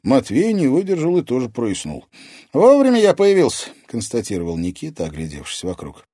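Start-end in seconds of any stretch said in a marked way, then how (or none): 2.5-3.7 put-on high, loud, cocky boy; pleased teenage boy; boyish character voice. A narrator's reading. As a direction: declare it in a ringing lad's voice. Boy's character voice, pleased entrance.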